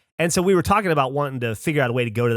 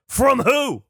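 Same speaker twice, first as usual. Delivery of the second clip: high, incredulous mock-angry challenge; retold indignant question, pitch leaping up; aggressively angry; 11 semitones higher, 4 dB louder than usual. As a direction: imitate an angry guard demanding an answer. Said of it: "aggressively angry at us".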